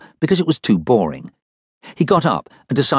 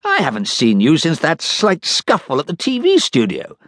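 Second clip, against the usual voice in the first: captain's voice; 8 semitones higher than usual. Acted. Captain talks to Dud.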